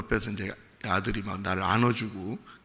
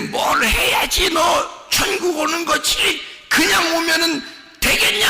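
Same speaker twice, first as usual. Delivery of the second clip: much higher, louder, slow mock-demanding God; mock stern God; 8 dB louder than usual. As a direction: shout it like a petty, demanding God. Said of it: "하나님이 우리한테".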